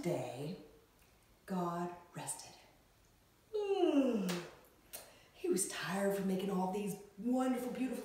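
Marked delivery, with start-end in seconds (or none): 3.5-4.5 long voiced sigh sliding downward; restful sigh. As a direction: let out a big sigh, settling into rest. Acts out God resting after creation.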